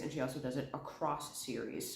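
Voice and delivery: usual speaking voice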